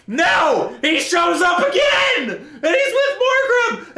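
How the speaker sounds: high-pitched laughing outrage, pace slowed; exasperated laughing shout. Real, not acted. Ranting about the book, a high laughing outraged shout. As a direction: cry out at the plot in disbelief, laughing.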